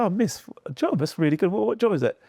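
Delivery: put-on eager young self. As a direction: play your younger self, keen and hopeful. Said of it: "And I said".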